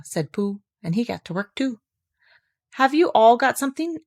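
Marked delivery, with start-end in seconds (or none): 2.7-4.0 talking through food; mouth full. A narrator's reading. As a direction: speak round a mouthful. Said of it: "with his mouth full".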